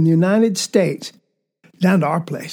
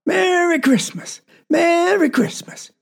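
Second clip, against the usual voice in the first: slow, much higher hollered greeting; Papa hollering from the wagon to his children, high and drawn out; mock yell; 9 semitones higher than usual. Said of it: "he was a-yelling".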